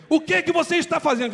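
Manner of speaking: mock angry towering father; scolding parent. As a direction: loom over the child and scold. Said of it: "Vem aquele monstro assim".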